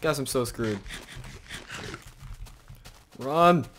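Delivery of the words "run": loud high shout of run; yelling while running away. Real, not acted. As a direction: bark the order while fleeing.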